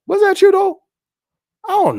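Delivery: squeaky teasing disbelief; high mock suspicion. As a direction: call out the claim with a teasing squeak. Teasing accusation.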